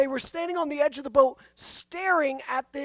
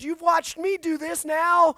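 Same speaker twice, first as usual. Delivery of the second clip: rousing command; 5 semitones higher, 8 dB louder than usual. Pretend. Jesus' great commission voiced.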